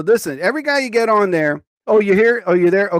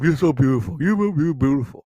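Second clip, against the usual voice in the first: mocking pushy guys; 3 semitones lower than usual. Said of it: "the word they can think of".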